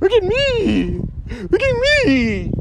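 high, drawn-out mimicry of attention seekers; mock vain cry. Preacher mimics people busy looking at themselves, mocking. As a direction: whine it high and slow, mocking the vanity.

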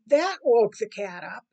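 plain reading voice

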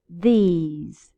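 these with a long falling ee; long ee vowel in these. Shown as a demonstration. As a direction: draw out the ee in these. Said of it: "Listen to the difference".

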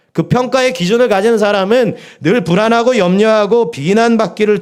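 rising fervent preacher's cry; preaching at full force. Acted. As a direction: hammer the warning home to the congregation. Preaching builds.

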